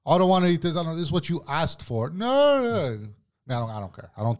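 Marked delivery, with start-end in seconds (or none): 0.1-3.0 high, whiny mock protest rising to a long "no"; complaining old man. Mocking a loser old man who always complains, high complaining voice. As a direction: imitate a fussy resident refusing food, pitched way up. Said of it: "Always complains".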